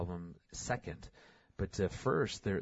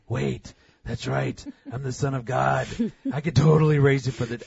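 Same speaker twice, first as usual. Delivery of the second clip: suddenly high and loud, a playful eureka; hammed-up realization, voice jumping up; mock triumphant Jesus; 5 semitones higher, 11 dB louder than usual. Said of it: "I don't think that was a".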